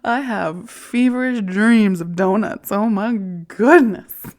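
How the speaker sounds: loud, slow, high gush; overwrought gush. Real, not acted. Admitting donut cravings, loud swooning gush.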